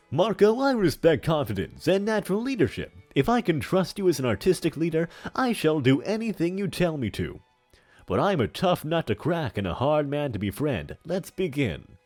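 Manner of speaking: put-on big theatrical old villain voice; hammy stage actor. Plays a hammy character in chat.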